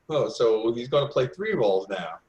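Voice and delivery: normal talking voice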